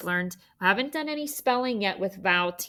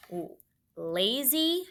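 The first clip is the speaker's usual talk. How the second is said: ordinary talk, nothing marked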